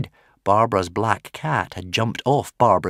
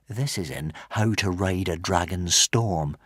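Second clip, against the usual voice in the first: ordinary delivery, nothing marked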